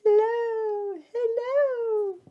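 very high, tiny sing-song greeting; little siblings waving and calling up; siblings calling hello. A narrator's reading. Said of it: "they all cry".

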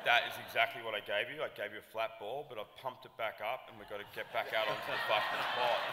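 loud, urgent rallying; rally a reluctant player back into action; retold pep talk. Retold pep talk to upset performer.

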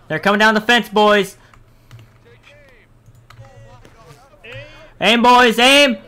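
rising loud, high commands; shouting orders over battle. Acted. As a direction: build the shout to the volley. Officer readies volley.

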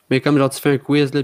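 usual speaking voice

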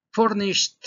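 "Furnished" with a clear final T, said "furnisht"; T sound at the end. Shown as a demonstration. Teacher showing the class a final T, "furnisht". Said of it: "la pronunciación de este es con una T".

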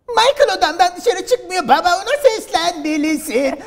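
put-on high, loud, drawn-out teen girl whine; ergen kız. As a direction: complain to dad like a dubbed schoolgirl. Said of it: "liseli ergen kız". (sızlanan liseli kız sesi)

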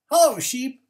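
high, loud cheery duck greeting. A narrator's reading. Duck greeting sheep, high and loud. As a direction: call out brightly as Duck. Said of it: "said Duck".

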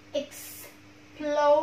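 "Explosion" very slow, in three separated parts; syllable by syllable. Shown as a demonstration. Teacher showing three syllables, slowly pulled apart. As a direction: break "Explosion" into ex, plo, sion with gaps. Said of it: "có ba âm tiết này".

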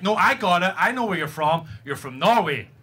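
normal talking voice

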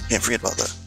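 ordinary delivery

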